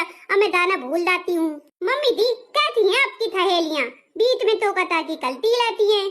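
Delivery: put-on childlike lisp, scolding the interrupters; lisping complaint. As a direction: complain indignantly in lisped baby talk. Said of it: "मैं भी तोतली हो जा रही हूँ". (तुतलाती बहू की शिकायत)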